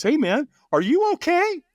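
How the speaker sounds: mock friendly concern; concerned stranger. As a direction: ask it like a worried helper. Stranger approaches him in crowd.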